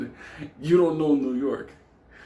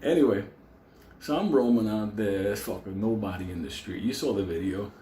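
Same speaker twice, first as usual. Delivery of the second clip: ordinary talk, nothing marked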